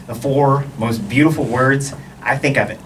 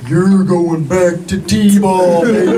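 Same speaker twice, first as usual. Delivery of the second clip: loud, very drawn-out slow-motion voice; slow-motion taunt; 4 semitones higher, 6 dB louder, much slower than usual. Acted. Mother's face on the flying ball, loud slow-motion taunting. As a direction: stretch every word as if time slows. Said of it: "everything goes in slow motion".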